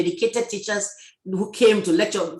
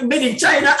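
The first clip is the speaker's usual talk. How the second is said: punchline dissolving into a laugh; 5 semitones higher, 5 dB louder than usual. Real, not acted. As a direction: say it while cracking up.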